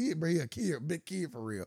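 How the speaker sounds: ordinary delivery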